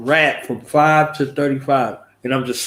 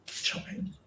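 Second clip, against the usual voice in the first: unvoiced whisper of the villain line; whispered threat; 18 dB quieter than usual. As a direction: breathe the threat out. Reenacting movie cameo.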